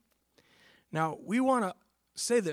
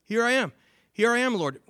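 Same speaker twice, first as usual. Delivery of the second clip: higher, louder "Here I am" three times; ringing declaration; 7 semitones higher, 7 dB louder than usual. Shown as a demonstration. Preacher voicing the faithful answer, loud and ardent. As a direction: call out each "Here I am" like an answer to God. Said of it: "It means".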